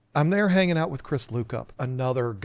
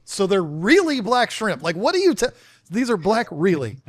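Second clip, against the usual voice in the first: higher, mock-confused questioning; retold bafflement; 6 semitones higher, 4 dB louder than usual. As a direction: act out being baffled by a term. Retelling his baffled mix-up over shrimp.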